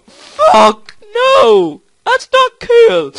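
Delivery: high, loud wail of protest; upset yelling. Real, not acted. Gamer protesting the crash, upset high yelling. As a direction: cry out in slow, high dismay.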